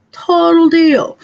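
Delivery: Total deal, loud, high and slow; excited sales-pitch shout; loud emphatic repeat. Shown as a demonstration. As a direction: sell it hard with a loud repeat.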